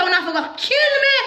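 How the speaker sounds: retold desperate wail; high crying shout. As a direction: pitch jumps way up in a desperate cry. Retold fight, bawling.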